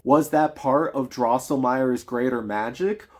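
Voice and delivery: normal talking voice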